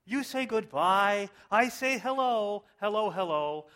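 mock-moved lyric recital. Preacher reciting Beatles lyrics to congregation, mock-passionate. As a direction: proclaim it high and theatrical. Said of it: "I hope you're as moved by these".

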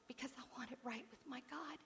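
breathy whispered confession. Real, not acted. Preacher confides to congregation in a whisper. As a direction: whisper it like a secret vow.

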